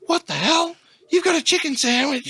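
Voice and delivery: very high, incredulous officer voice; high-pitched mock disbelief; shocked customs lady. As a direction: mimic an outraged lady at customs.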